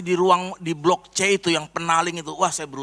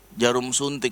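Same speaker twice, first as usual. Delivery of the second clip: ordinary talk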